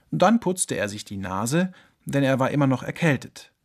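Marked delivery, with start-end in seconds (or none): none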